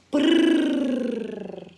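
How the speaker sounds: rolled brrrr purr. Acted. Cousins hovering above Caramba, loud purring. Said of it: "purring loudly".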